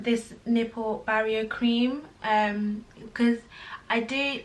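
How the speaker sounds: ordinary talk, nothing marked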